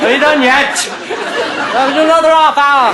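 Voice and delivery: high, loud call up to the belfry. Retold or imitated. Crew shouting up to actors stuck in the belfry, loud and high. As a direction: shout it up to the actors hanging above.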